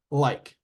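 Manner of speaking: "like" alone, closing on K; present-tense word demo. Shown as a demonstration. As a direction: model "like" as an isolated word. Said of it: "say it in the present tense".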